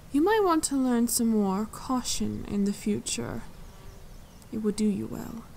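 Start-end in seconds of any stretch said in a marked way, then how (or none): none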